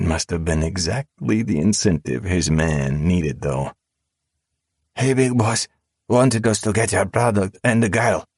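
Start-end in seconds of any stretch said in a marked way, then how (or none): 5.0-8.3 high, panicked confession; frightened blurting. A narrator's reading. Terrified henchman spills the secret to save himself, high and panicked. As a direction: blurt it out in terror, voice pitched up. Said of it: "The coward breathed heavily".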